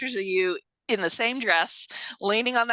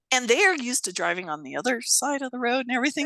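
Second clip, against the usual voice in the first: ordinary delivery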